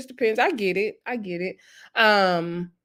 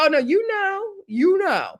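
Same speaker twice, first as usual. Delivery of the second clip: knowing exclaim; 11 semitones higher than usual. Retold or imitated. Speaker reacting to his dodge.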